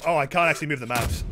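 ordinary talk

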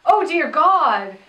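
imitating a flustered panic, slowed; half-hearted horrified gasp; acting out squirming panic; mock panic. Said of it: "Sheer panic".

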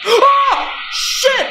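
high frightened yelp; startled yelp. Real, not acted. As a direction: jump at a sudden scare. Jump scare in horror game.

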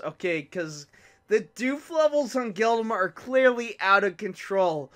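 ordinary talk, nothing marked

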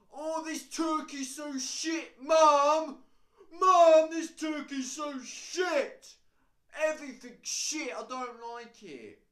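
slow, high, yelled whinge at Mum; loud moaning.

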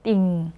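"띵" low with sustained ng; teacher's low model of "띵"; demonstrating "띵". Shown as a demonstration. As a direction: draw out the nasal end of "띵". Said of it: "이렇게 해주시면 돼요".

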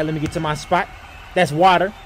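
ordinary talk, nothing marked

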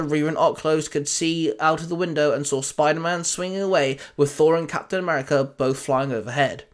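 plain reading voice

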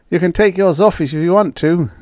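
emphatic comeback in character. A narrator's reading. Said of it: "Canham returned emphatically".

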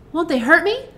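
high-pitched, mildly worried question; a touch of worry in a girl's voice; anxious. A narrator's reading. Dorothy asking the Queen about the monkeys, anxious. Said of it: "asked the girl anxiously".

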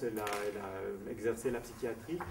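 usual speaking voice

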